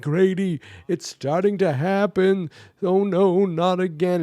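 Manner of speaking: high, pleading cry of rising dread; panicked plea. Retold or imitated. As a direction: beg your friend as the nightmare takes hold. Jesse panicking during nightmare.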